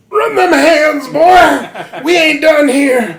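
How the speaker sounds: high, loud, laughing battle cry; hammed-up shouted taunt. Feverish Guts challenging Griffith, loud shout. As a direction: roar the challenge.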